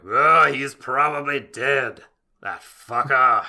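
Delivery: slow, loud cartoonish villain voice; Askeladd impression. Co-host asks about the line, so he hams Askeladd loud and slow. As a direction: ham up Askeladd, loud and drawn out. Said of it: "it was my Askeladd".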